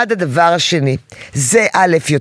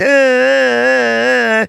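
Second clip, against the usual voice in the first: slow, drawn-out mock toddler wail, higher and strained; imitated child crying; 5 semitones higher, much slower than usual. Mother mimics her toddler crying for attention with drawn-out mock wails. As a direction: stretch out a fake baby cry. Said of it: "ואז הוא התחיל לבכות".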